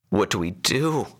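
frightened, shaky question; shaking voice. A narrator's reading. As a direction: ask helplessly from the back seat. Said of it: "The woman's voice shook".

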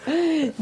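short high laugh; a quick laugh. Real, not acted. Laughing fit.

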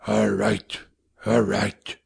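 low grunting father, barely above a whisper; hoarse grunt. A narrator's reading. Father grunting at his wife in a hoarse near-whisper. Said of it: "in a hoarse grunt, little above a whisper".

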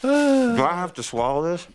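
gagging on food; trying not to gag. Real, not acted. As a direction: mouth full, fighting the gag reflex. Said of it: "I'm trying not to gag".

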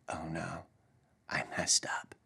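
very soft, low, near-whispered admission; hushed dismay. Retold or imitated. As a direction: imitate a child's sinking realization, barely audible. Daughter realizing her mistake, retold.

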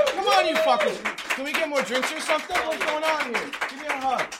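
loud, high calling out to the room; shouted stage banter, pitched high; rowdy host shouting. Real, not acted. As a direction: holler over a cheering crowd. Host calls out to crowd.

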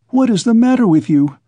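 mother's cry. A narrator's reading. Alarmed mother to her strangely behaving son, crying out. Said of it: "cried his mother".

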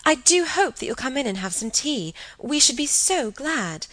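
high, eager hostess voice; gushing invitation. Acted. Same invitation, eagerly acted.

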